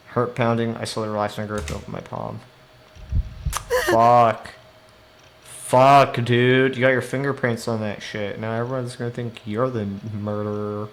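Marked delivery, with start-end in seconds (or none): 3.8-6.7 loud alarmed cursing; loud curse. Real, not acted. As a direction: yell it in dismay. Panics about fingerprints.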